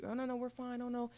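airy dismissive director. Retold or imitated.

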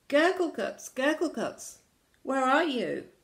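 high, loud searching call. Acted. Crew looking for lost Gurgleguts, calling out. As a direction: holler for someone out of sight.

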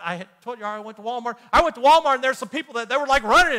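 ordinary delivery

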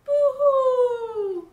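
loud, high mock wail; crying baby. A narrator's reading. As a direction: bawl it out like a baby. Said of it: "make the baby stop crying".

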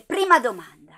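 loud announcement. Acted. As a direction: call out the first question. Annuncia la domanda. (annuncio a voce alta)

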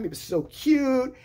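ordinary delivery

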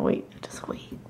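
breathy unvoiced whisper. Real, not acted. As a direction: whisper it under your breath. Whispered aside.

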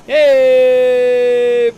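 loud, held cheering call; excited whoop. Real, not acted. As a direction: celebrate the position gain. Celebrating ninth place.

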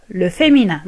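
ordinary talk

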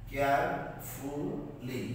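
কেয়ারফুলি very slow, broken into three separate parts; syllable by syllable. Shown as a demonstration. Teacher splitting a word for English learners, syllable by syllable. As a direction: draw out each syllable of কেয়ারফুলি with gaps between. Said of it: "তিনটা করে বিভক্ত".

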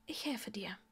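whispered offer. Acted. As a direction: breathe it as a whisper. Granger offers help quietly.

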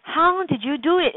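high, eager asking; curious question. Shown as a demonstration. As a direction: ask it with rising curiosity. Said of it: "Is it".

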